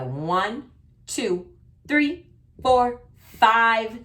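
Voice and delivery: high, slow, sing-song counting. Shown as a demonstration. Teacher counting silly mistakes sing-song.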